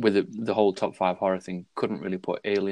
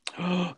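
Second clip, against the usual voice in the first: mock gasp; 10 semitones higher, 3 dB quieter than usual. Mock shock at a hot take.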